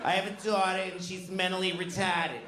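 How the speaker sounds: Boston teacher lecturing the class. Retold or imitated. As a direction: imitate a fifth-grade Boston sex-ed teacher. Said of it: "She used to tell us every day".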